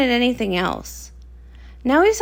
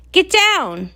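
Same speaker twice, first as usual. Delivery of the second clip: higher, sharp call to duck; urgent warning; 6 semitones higher than usual. Acted. Guard warns Rockhoof to duck, urgent call. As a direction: yell it to get them down fast. Sudden danger at the volcano.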